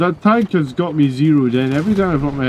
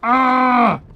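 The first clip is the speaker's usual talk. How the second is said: frustrated cry; 11 semitones higher than usual. Real, not acted. Cry after being shot.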